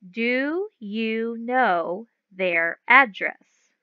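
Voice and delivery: very slow, clear question; slow read-aloud. Shown as a demonstration. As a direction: read it out slowly for the class. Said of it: "It says".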